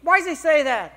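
high, loud mock outrage; exasperated complaint. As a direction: wail the grievance in exaggerated protest. Retold complaint to God in car.

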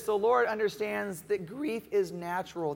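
usual speaking voice

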